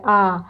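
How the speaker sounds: आ held long and soft; drawn-out आ; long vowel held. Shown as a demonstration. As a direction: hold the आ long. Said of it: "इसको थोड़ा देर तक हम लोग बोल रहे हैं".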